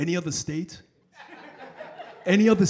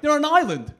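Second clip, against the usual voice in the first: mock incredulous, pitched up; exasperated friend; 6 semitones higher than usual. Friend setting a complaining New Yorker straight, mock exasperation. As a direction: retort as a baffled friend, higher voice.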